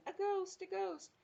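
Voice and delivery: very high mock-panic cry; panicked yelling. A narrator's reading. Townspeople seeing an empty dressing gown walking, high panicked yell. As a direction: squeal it like a frightened crowd. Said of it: "with everybody yelling".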